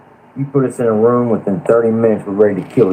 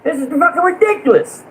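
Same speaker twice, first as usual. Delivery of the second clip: much higher, furious shout; real fury. Real, not acted. Fed-up panelist losing it at the feuding channel, high furious shout. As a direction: let the real rage push the voice way up. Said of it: "I'm getting pissed right now".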